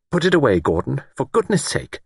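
high-pitched, sharp reproof; sharply. A narrator's reading. Woman tells lord to put knife away, sharply. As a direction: scold him into putting it down. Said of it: "Miss Wainfleet said sharply".

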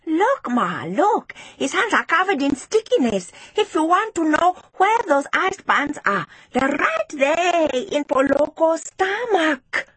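high-pitched, insistent tattling; eager, indignant schoolgirl telling on someone; excited accusation. A narrator's reading. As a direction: urge the teacher in a high child's voice. Child loudly accusing classmate to teacher.

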